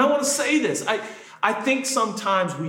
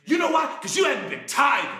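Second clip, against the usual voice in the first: accusing shout; 7 semitones higher, faster than usual. Acted. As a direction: snap the verdict out. Acting judgmental church people.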